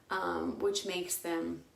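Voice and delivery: ordinary talk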